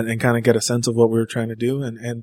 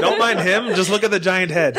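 put-on old-time radio announcer, higher and laughing; 1940s newscaster voice; 5 semitones higher than usual. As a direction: announce it like a vintage newsreel. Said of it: "that nineteen forties newscaster voice".

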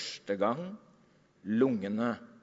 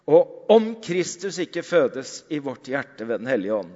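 normal talking voice

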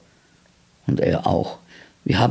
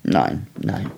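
low, subdued, downcast; sad; 8 semitones lower, 4 dB quieter than usual. A narrator's reading. Jürgen cannot leave to see the rabbits, quietly sad. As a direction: give up softly, with quiet regret. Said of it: "sagte Jürgen traurig".

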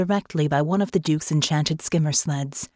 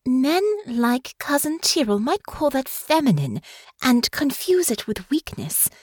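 put-on higher, earnest young woman; sincere reassurance; 7 semitones higher than usual. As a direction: reassure him tenderly. Honor comforts father.

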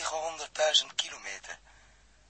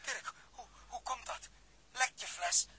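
tearful shock; 9 semitones higher, 10 dB quieter than usual. Acted. Shocked, crying over Dirk.

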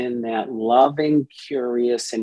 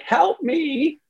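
part crying out; 14 semitones higher than usual. Retold or imitated.

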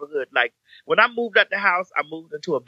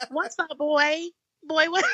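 high, soft come-hither chat line voice; flirty high chat line voice; 11 semitones higher, 4 dB quieter, slower than usual. Retold or imitated. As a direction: purr it like a sexy phone line. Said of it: "very chat line voice".

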